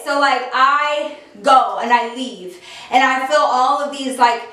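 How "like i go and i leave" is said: usual speaking voice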